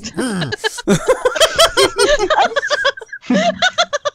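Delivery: loud high-pitched laughing fit; high burst of laughter. Real, not acted. Bursts out laughing mid-sentence.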